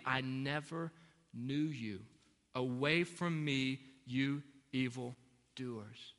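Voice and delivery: low, slow words of dismissal; plain, solemn rebuke. A narrator's reading. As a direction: read it gravely, slowing down with pauses between phrases. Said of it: "Then I will tell them plainly".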